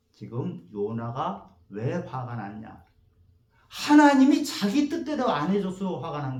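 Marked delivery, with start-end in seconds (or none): none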